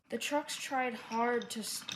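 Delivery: plain reading voice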